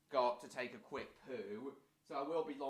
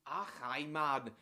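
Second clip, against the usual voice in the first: put-on light young Geordie lad, a bit louder; Jack voice; 2 semitones higher, 5 dB louder, slower than usual. Streamer tries his Jack voice for Robert, lightly voiced lad. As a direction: throw out a lazy lad's greeting. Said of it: "With this voice".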